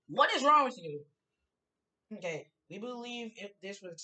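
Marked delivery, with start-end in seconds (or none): 0.1-1.0 loud exasperated yell. Real, not acted. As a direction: yell it in disbelief. Yelling at the game.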